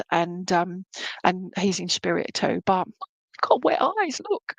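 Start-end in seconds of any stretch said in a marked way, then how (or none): 3.4-4.5 fighting back real tears; real tearful. Real, not acted. Medium moved by memories of her own dad, tearful. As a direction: pitch jumps higher as tears come. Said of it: "I'm getting upset myself".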